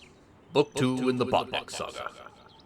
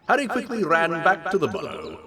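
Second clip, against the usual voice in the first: usual speaking voice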